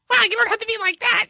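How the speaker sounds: very high put-on voice; hammed-up high-pitched protest; mock offended wife. Wife answering her rude husband, mock offended. Acts out the wife's offended reply.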